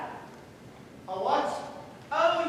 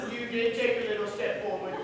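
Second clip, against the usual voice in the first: usual speaking voice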